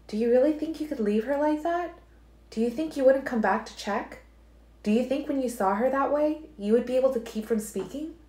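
Alice challenging Edward about whether he could stay away; Alice, pressing. A narrator's reading. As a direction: lift into Alice and press each question.

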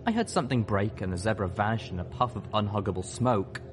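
usual speaking voice

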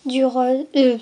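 ordinary talk

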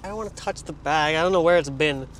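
usual speaking voice

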